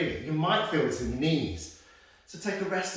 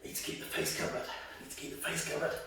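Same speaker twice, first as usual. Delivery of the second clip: imitating Stuart; 10 dB quieter, faster than usual. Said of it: "he'd be telling you".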